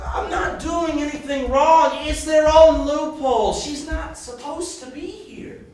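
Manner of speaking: high, loud, insistent; indignant protest. Acted. Told wrongdoers are punished, indignant protest. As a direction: protest your innocence hotly.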